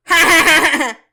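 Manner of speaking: loud, mocking ha-ha laughter. Guy mocking her laugh to her face, loud jeering ha-ha. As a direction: imitate a guy laughing at her cackle to tease. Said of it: "to make fun of my laugh".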